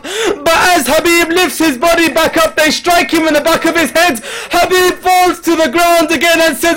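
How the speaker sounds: very high, loud, wailing recitation; lamenting at full force. Acted. Preacher mourning Habib's fall before mourners, wailing. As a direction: cry the martyrdom out to the mourners.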